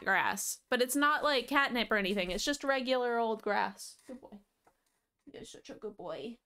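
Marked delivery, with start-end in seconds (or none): 4.1-6.4 tender murmur to the cat; soft pet praise. Real, not acted. Streamer praising the cat Harvey, soft tender murmur. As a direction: praise him much softer, almost hushed.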